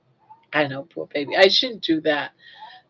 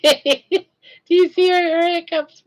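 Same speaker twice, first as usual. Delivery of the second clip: high slow laughing question; 9 semitones higher, 6 dB louder, slower than usual. Real, not acted. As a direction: giggle through the question. Laughing while showing the hiccups.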